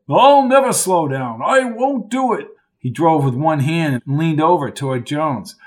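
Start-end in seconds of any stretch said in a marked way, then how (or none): 0.1-2.5 lightly voiced old Lark, high and jolly; a hint of a jovial old-timer calling him old boy; hearty old college man. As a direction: play the chummy old coach, a touch higher. Lark refusing to slow down.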